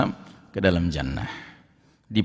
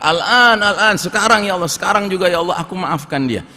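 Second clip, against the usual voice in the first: much higher, louder cry that fades; eager shout; 7 semitones higher, 4 dB louder than usual. Acted. Servant rushing to forgive his brother, eager shout. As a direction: cry out at once, desperate to agree. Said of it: "dia langsung teriak".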